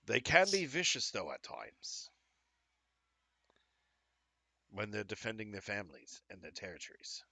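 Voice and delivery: loosely put-on British, dropping away at the end; butchered British accent. Game master as Jack warning about puffer pigs, rough British accent. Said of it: "I butchered a British accent".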